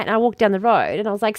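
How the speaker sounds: ordinary delivery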